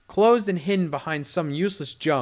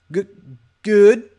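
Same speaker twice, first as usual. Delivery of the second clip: nervous stammer; 5 semitones higher, 10 dB louder than usual. Acted.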